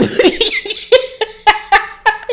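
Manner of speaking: a real high laugh breaking out; laughing hard at the shade; high burst of laughter. Real, not acted.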